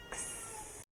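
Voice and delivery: كس hissed, breathy and high; X sound demo. Shown as a demonstration. Teacher whispering كس for the X sound. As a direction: whisper the كس sharply. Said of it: "ساوند اسمه كس".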